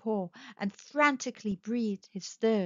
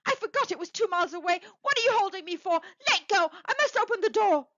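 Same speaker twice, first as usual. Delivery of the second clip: high, urgent pleading demand; frantic cry; 9 semitones higher, 3 dB louder, faster than usual. A narrator's reading. Mother demanding her husband let her open the door, frantic. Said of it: "struggling mechanically".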